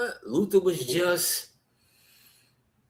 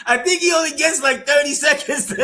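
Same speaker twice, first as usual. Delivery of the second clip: breaking into laughter, much higher; laughing; 12 semitones higher, 5 dB louder, faster than usual. Real, not acted. Friend cracking up over a movie moment. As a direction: laugh through the last words.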